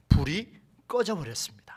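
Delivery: ordinary talk, nothing marked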